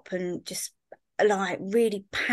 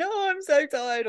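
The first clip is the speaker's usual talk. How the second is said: higher, slow, tearful moan; imitating a teary voice note; 9 semitones higher, slower than usual.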